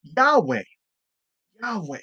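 "Yahweh" said as YAH-way; pronouncing Yahweh. Shown as a demonstration. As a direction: set out "Yahweh" alone as two syllables, YAH-way. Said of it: "it was actually pronounced".

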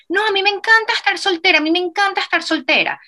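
high mocking girly voice; mocking a friend. Mocking a friend who brags about being single, high mock voice. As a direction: parody a friend who swears she loves being single.

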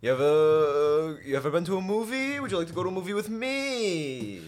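a hint of a creepy come-on; mock creepy governor. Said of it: "He said".

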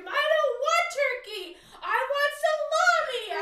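tantrum over turkey; wife shrieking at her friend over turkey instead of salami; freaking out. Retold or imitated. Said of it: "just freaking out".

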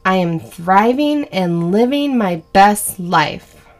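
slow, deliberate affirmation for listeners to repeat. Shown as a demonstration. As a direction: spell it out slowly so they can follow along. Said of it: "I'll say it pretty slow so you can say it with me".

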